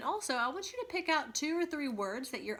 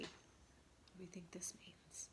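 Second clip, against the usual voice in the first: a soft unvoiced whisper; 3 semitones lower, 19 dB quieter, slower than usual. Real, not acted. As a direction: lean in and whisper the question. Teacher asks class mid-story.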